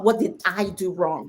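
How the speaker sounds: ordinary talk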